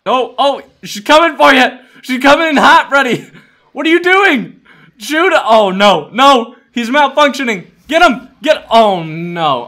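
high, loud frantic commands; panicked shouting. Real, not acted. Player to Freddy as enemy comes in hot, panicked shouting. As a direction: shout urgent warnings and orders.

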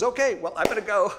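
high, loud mock chirp; awkward exit. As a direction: mimic a flustered lady making her escape. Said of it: "She has no idea what to say".

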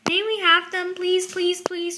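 insistent little cub's plea; eager begging. A narrator's reading. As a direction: beg in a string of pleases. Cub begs for toys.

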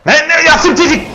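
high, agitated protest; exasperated outburst. Real, not acted. Player ordering a game enemy around, exasperated and high. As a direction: yell at it, pitch jumping up.

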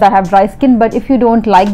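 talking while scrubbing face; fingers rubbing at lips. Real, not acted. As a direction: hand brushing across mouth. Seen 0: fingers scrub paste around lips and chin while talking.

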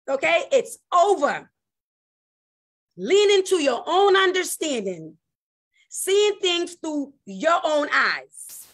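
high, forceful preacher's voice; emphatic preaching with high, punched phrases; impassioned preaching. Real, not acted. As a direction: drive the point home to the congregation, pitch lifted. Preaches at raised pitch.

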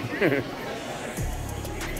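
short soft chuckle; amused laugh. Real, not acted. Vlogger reacting to the checkout line, soft amused chuckle.

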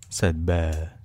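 ordinary delivery, nothing marked

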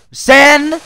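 high, loud yell; piercing scolding cry; exasperated shout. Real, not acted. Yelling at the misbehaving sand.

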